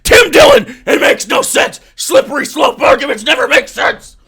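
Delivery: shouting, pitch jumping way up on the name; worked up. Real, not acted. Arguing with Tim Dillon's clip, worked-up shouting. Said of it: "I'm getting worked up".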